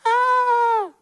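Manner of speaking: sudden high shriek of fright; hammed-up shocked "Ah!"; mock terrified scream. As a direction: shriek like a startled disciple seeing a ghost. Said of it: "wo sie wirklich so erschüttert".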